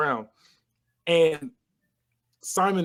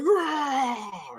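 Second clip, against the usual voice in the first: flexing roar; 8 semitones higher than usual. Retold or imitated. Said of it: "he's like".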